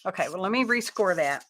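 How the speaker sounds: usual speaking voice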